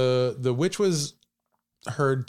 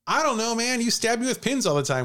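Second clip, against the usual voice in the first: put-on high whiny teenage voice; bratty teen girl voice; 12 semitones higher than usual. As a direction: play it like a sulky, defiant kid. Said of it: "Betsy's like".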